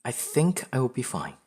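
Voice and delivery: upbeat, emphatic reassurance to oneself; self-assured. Acted. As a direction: tell yourself confidently, lifted on "think". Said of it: "There's always self-doubt".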